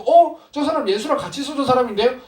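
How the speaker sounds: high startled pointing-out; accusing surprise. Retold or imitated. Bystander recognizing Peter, high surprised accusation. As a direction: call it out, surprised. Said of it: "도망가 거기서도".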